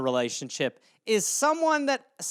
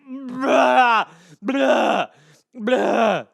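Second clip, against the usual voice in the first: loud, high fake retching; 4 semitones higher, 6 dB louder, much slower than usual. Comic acting out throwing up the bad yogurt, loud fake retch. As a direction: gag it out like you're vomiting. Vomiting imitation.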